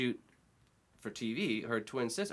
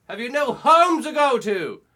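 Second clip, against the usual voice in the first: exasperated shout; 12 dB louder, slower than usual. Retold or imitated. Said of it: "I just went".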